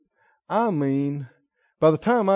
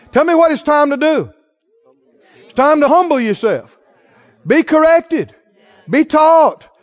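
higher, louder, driving sermon cadence; full-force preaching; 8 semitones higher, 8 dB louder than usual. Acted. Preacher urging the congregation not to quit, at full force. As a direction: exhort them hard, line after line.

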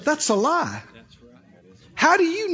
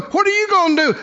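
high-pitched defiant holler; 8 semitones higher, faster than usual. Shown as a demonstration. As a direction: holler it as a bold challenge. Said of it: "I'm hollering and going".

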